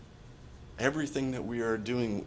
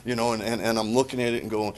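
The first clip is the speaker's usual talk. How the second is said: normal talking voice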